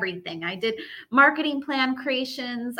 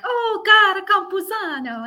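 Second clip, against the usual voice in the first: loud, high mock-Italian exclamation; Italian accent; 9 semitones higher, 6 dB louder than usual. People who think she's Italian greeting her, Italian accent. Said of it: "some people think I'm Italian".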